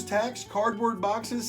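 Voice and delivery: usual speaking voice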